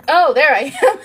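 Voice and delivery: bright laughing "oh, there I am"; a sudden laughing aha moment; laughing realisation. Retold or imitated.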